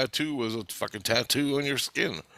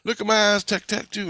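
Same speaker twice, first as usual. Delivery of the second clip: put-on giddy kid flaunting a new tattoo; excited showing off; 9 semitones higher than usual. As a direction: call out to friends, thrilled with the tattoo. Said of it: "and I go".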